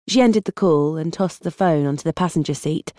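ordinary delivery

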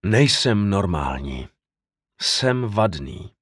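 slow, low, sinking delivery; heavy, halting self-condemnation; dejected self-reproach. A narrator's reading. Narrator's despairing self-condemnation after fleeing.

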